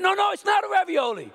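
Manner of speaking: high, loud, fast indignant tirade; comic outraged rant. Retold or imitated. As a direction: rail against the restaurant, mock-furious. Ranting at restaurant, shouting.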